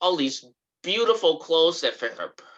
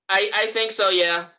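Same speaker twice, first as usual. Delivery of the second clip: real raised voice, higher pitched; calling back; 5 semitones higher, 4 dB louder than usual. Real, not acted. Answering mom during the recording, calling out. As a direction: project an answer across the house.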